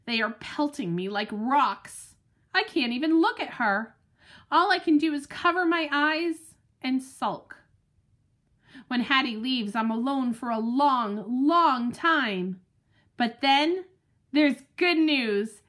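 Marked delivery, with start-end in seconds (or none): none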